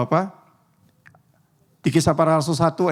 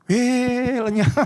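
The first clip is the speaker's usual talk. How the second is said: mock relief; 6 semitones higher, much slower than usual. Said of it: "Atau Anda bersyukur".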